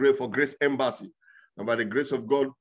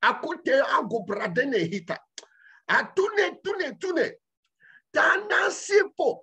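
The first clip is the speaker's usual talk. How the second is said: high shouted tongues, slowing at the end; tongues demonstration; 10 semitones higher, slower than usual. Shown as a demonstration. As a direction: show them how heaven's language sounds. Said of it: "Did you ask yourself".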